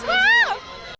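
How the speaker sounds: high whooping cheer; excited cheer. Real, not acted. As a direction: whoop with delight. Spots Neil Patrick Harris, cheers.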